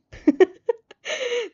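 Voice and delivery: high, loud cackling laugh; burst of laughter. Real, not acted. Player laughing at Shoto's one-word reply. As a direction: crack up helplessly.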